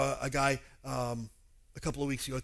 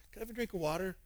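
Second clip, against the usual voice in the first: softer, patient ask; gentle repeated request; 3 semitones higher, 7 dB quieter, faster than usual. Retold or imitated. Jesus gently persisting with Samaritan woman, soft request. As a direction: ask it softly, gently insisting. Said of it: "He goes, "No".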